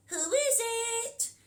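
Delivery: wolf faking grandma's voice; cackly voice. Acted. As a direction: call out like a cackly granny. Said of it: "he called in a cackly voice".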